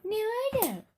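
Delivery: high mocking echo of the kid; mocking imitation. Mom mimics kid.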